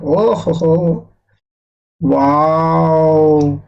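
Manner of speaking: hammed-up delight; mock excitement. Shown as a demonstration. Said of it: "बड़ा खुश हुए हैं". (बनावटी ख़ुशी से "वाओ")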